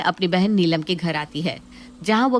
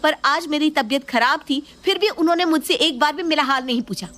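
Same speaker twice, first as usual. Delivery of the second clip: high, fast furious wife; angry outburst; 7 semitones higher, faster than usual. Acted. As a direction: let it burst out at your husband. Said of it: "इतना गुस्सा क्यों कर रही हो?".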